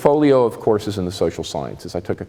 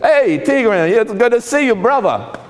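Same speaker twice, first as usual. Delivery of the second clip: New Jersey working-class accent; 9 semitones higher than usual. Shown as a demonstration. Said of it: "I talk like this".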